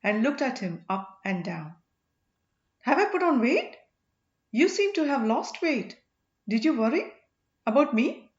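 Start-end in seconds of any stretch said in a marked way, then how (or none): 2.8-8.3 put-on a touch higher, eager young woman; lightly voiced young woman, teasing back; Valli's lively voice. A narrator's reading. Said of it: "said Valli".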